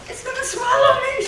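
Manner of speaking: put-on high, loud panicked old woman; frightened cry. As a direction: squeal it out in fright as grandma. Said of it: "she says".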